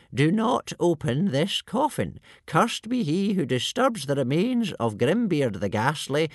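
put-on high, creaky old sage warning; ancient elder voice. A narrator's reading. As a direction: sound like the oldest wisest elder, cautious. Old Wrinkly's long warning.

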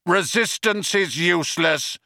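high, brutish guard shout. A narrator's reading. Vogon guard shouting back at Ford, shouted. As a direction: call out the stock phrase forcefully. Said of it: "shouted the Vogon guard".

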